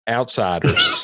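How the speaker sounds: hammed-up hillbilly sneer; mock menace. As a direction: spit the word out like a suspicious local. Mock-menacing echo of the word.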